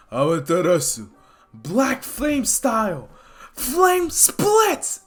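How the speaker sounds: high, loud slow battle cry; attack call. Acted. Izuku unleashes his new technique, shouted battle cry. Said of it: "Izuku just shouts".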